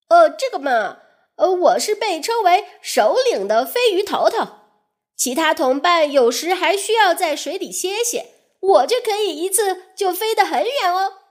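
a leader bragging a little; proud. Acted. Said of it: "飞鱼首领自豪地说". (自豪地炫耀)

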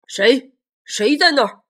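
loud, menacing monster voice; scary voice. Acted. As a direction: boom it out to frighten. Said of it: "用很可怕的声音问".

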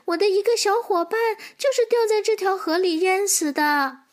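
high-pitched, serious little squirrel; earnest squirrel. Acted. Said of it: "松鼠认真地说". (小松鼠尖着嗓子认真地说)

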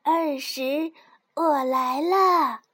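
loud call. A narrator's reading. 蹦蹦 shouts to the hiding piglet, slow and loud. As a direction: call out loud, drawn out slowly. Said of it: "蹦蹦大喊一声".

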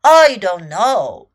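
deep, loud, drawn-out lion voice; dismissive lion. A narrator's reading. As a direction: say it low and slow, with weight. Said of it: "said Lion".

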